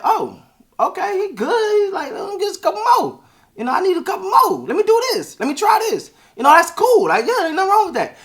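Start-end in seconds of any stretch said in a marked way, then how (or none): 0.0-6.1 much higher, bright mock voice; eager, upbeat version. Shown as a demonstration. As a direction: play it light and eager, like it's all cool. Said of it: "it sounds like".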